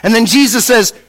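ordinary talk, nothing marked

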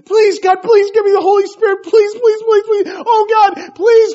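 high, loud, frantic pleading prayer; hammed-up tearful pleading, piled up fast; mock desperate begging. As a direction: act out begging God like a whining kid. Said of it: "get on our knees and do that".

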